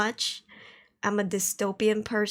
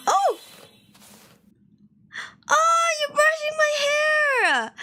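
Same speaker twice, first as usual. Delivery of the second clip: excited; 3 dB louder than usual. Real, not acted. Surprised by chat redeem.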